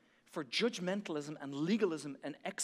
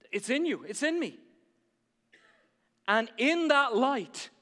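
preaching at full force; 8 semitones higher, 10 dB louder than usual. Acted. Preaching climax.

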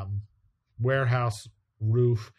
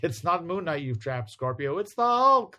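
dramatic reveal; 7 semitones higher than usual. Retold or imitated. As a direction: announce the twist, rising to a shout. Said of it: "I guess they're going like".

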